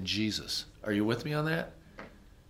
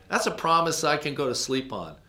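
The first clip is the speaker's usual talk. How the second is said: ordinary talk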